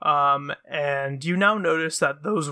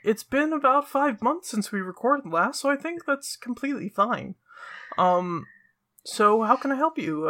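bright squeaky sing-song welcome; 7 semitones higher than usual. Acted. GM speaks high as NPC.

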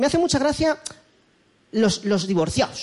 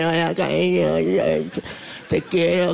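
low moaning zombie, a little lower; lightly voiced club zombie; 7 semitones lower, much slower than usual. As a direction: groan it like a disco zombie falling apart. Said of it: "el zombie de la discoteca". (un divorciado zombie gimiendo en la discoteca)

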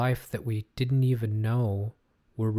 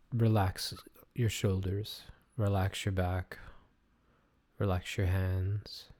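soothing massage murmur; 2 semitones lower than usual. Acted. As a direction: guide them into calm, gently. Leads breathing meditation.

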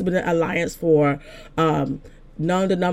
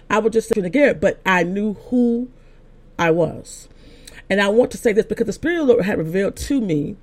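ordinary talk, nothing marked

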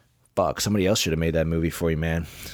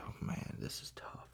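very soft, whispered aside to self; hushed, deflated reaction; dismayed mutter; 17 dB quieter than usual. Retold or imitated. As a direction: say it under your breath, barely voiced. Voicing own reaction to the film.

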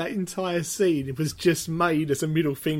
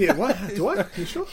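rising, louder playful disbelief; mock confusion; 2 semitones higher, 3 dB louder than usual. [name] told he signs with an accent, mock baffled. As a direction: play [name] baffled, climbing up.

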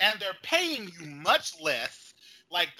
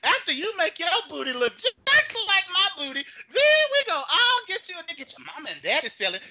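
louder, higher, fast hype ending in a shout; hammed-up pitch; 6 semitones higher, 5 dB louder than usual. Mock influencer pushing a pyramid scheme, shouting.

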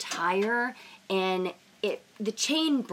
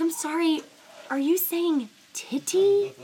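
disbelief; 9 semitones higher, 4 dB louder than usual. Retold or imitated. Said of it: "I was like".

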